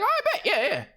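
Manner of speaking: quick high-pitched mimicry of her; imitated girl. Girl agrees to keep it casual, mock high voice. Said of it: "She's like".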